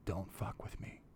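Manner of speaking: menacing whisper; quiet final warning in his ear; low whispered threat. Retold or imitated. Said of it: "I'd go over there and be like".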